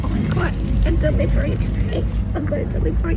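excited high whisper; hushed, thrilled whisper. Real, not acted.

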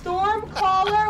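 announcing. Real, not acted. Reading the boss name.

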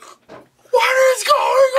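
very high, drawn-out shriek of disbelief; baffled fan outcry. Retold or imitated. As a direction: draw it out, squealing in bewilderment. Acts out baffled viewer.

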